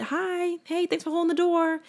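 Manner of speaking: high, soft, sugary hello to a stranger; high soft polite hello to someone holding the door; sweet friendly greeting. Pretend. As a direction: breathe a shy, friendly hi. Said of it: "Wave a little bit".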